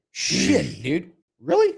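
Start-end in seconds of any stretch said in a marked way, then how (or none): 1.4-1.7 pitched way up high and louder; stunned disbelief. Real, not acted. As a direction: fire it back in total disbelief. Disbelief at deleted post.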